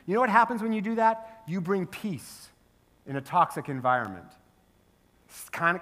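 normal talking voice

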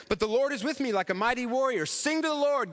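high-pitched joyful proclamation; happy praise. Acted. Jeremiah praising God a few verses later, happy. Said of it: "he gets all happy and he says".

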